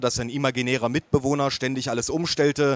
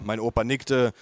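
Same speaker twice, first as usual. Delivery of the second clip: ordinary delivery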